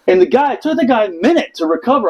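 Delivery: ordinary talk, nothing marked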